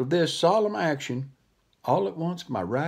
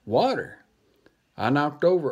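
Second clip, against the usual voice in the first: ordinary delivery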